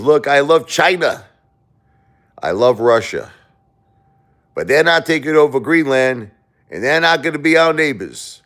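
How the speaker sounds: high, loud put-on Trump voice; imitating Trump. Telling China and Russia to keep off Greenland, mock Trump voice. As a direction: ham up Trump swaggering, pitched high. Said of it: "He goes".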